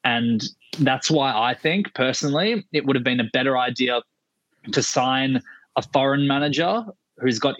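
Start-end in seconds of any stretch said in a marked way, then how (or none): none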